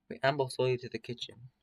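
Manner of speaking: ordinary delivery, nothing marked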